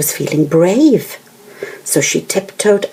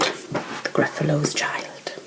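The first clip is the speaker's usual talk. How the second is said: whisper; 10 dB quieter, slower than usual. Acted. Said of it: "whispers the title to children".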